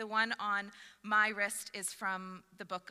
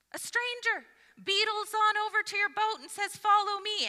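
incredulous; 10 semitones higher, 8 dB louder than usual. Acted. Incredulous retelling of the calling.

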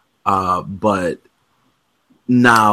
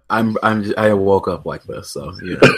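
ordinary talk

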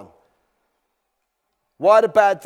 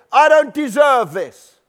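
slow, louder, higher indignant complaint; indignant protest; 6 semitones higher, 7 dB louder than usual. Acted. Aggrieved believer protesting to God, loud and indignant. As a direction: insist on the injustice, drawn out.

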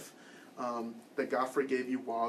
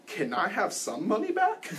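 higher, slow, half-laughing plea; laughing disbelief; 8 semitones higher than usual. Retold or imitated. Retelling asking the clerk for a refund, half-laughing. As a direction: laugh through the request in disbelief.

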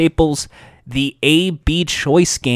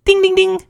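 high sung ding ding ding; imitated bell ding. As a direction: ring it like a game bell.

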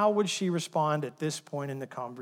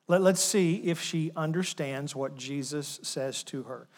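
ordinary talk

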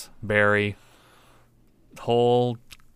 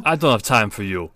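dismissive barman; 7 dB louder than usual. Acted. Curt half-elf barman brushes off Vass.

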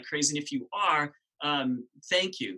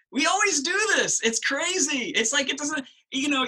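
amused outburst; 9 semitones higher, 5 dB louder than usual. Real, not acted. Amused at how people always complain.

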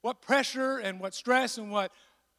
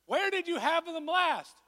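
higher, lightly voiced wife; mock wife voice; 9 semitones higher than usual. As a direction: imitate the wife's calm question. Wife's wise question imitated.

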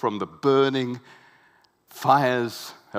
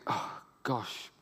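softer, hushed self-doubt; crushed inner voice; 6 dB quieter than usual. Retold or imitated. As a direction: murmur it as if to yourself. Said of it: "where you think".

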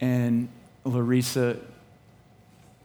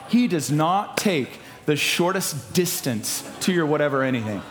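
high, loud preacher delivery; full-force preaching; 10 semitones higher, 5 dB louder than usual. Real, not acted. Preacher urging the church to let the Spirit lead, full-force preaching. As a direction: drive it home to the congregation, loud and high.

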